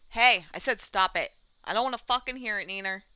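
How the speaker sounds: loud, sharp telling-off; scolding. Real, not acted. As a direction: firmly tell a pet to cut it out. Scolding the noisy cat.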